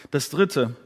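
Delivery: ordinary talk, nothing marked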